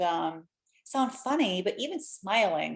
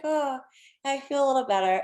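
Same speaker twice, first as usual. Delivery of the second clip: put-on high, sheepish little-boy voice; imitating her son; 6 semitones higher, 3 dB louder than usual. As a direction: play the kid admitting he feels better, pitched up. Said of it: "He's like".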